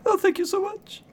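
frightened cow Claire. Acted. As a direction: squeak it out like a scared cow that saw nothing. Cow Claire keeps talking.

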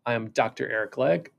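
ordinary talk, nothing marked

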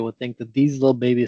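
ordinary talk

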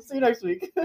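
giggling mock sign-off; high laughing sign-off. Real, not acted. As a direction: laugh through a fake goodbye.

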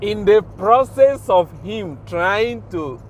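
normal talking voice

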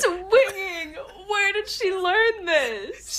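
high and breaking into laughter; laughing. Real, not acted. Friend laughing about the fight, high and giggly. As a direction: laugh through disbelief at the scene.

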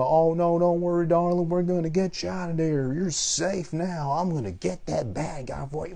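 high, syrupy reassuring officer voice; mock sweet cop talk. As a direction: coo it like a patronizing cop. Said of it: "But no".

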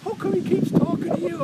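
high, loud indignant whine; jealous complaint. Retold or imitated. Jealous friend complains to his pal, loud and indignant. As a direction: protest like the friend left out. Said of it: "the guy I'm with is getting jealous".

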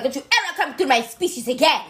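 hammed-up French-accented warning. Woman warning the man off, mock French accent. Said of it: "to do the French accent".

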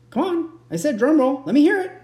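insistent demand. Pretend. Demands drum roll from the audience.

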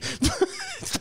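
squealing laugh; high shriek of laughter. Real, not acted. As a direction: cracks up at own joke. STD joke laugh.

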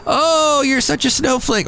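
high mock-adoring coo on "Oh"; exaggerated fan gush, dropping back down. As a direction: imitate a fawning fan, starting very high. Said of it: "people would be like".

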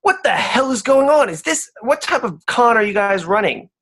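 loud, high outraged demand; angry barmaid. Acted. Barmaid to the thieves, loud and high outrage. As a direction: yell it as you catch the con. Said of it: "And she goes".

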